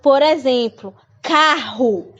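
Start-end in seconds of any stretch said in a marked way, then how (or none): 1.2-2.1 carro with the strong R, "kahu"; strong double R. Shown as a demonstration. Teacher contrasting carro with caro. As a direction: make the RR in carro throaty. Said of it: "Se tivesse só um R aí, seria caro".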